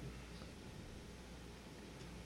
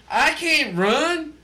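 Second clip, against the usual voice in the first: exasperated protest; 7 semitones higher than usual. Retold or imitated. Storyteller explaining his concrete legs to listeners, exasperated. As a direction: plead helplessness, amused and high-pitched. Protesting he could not run.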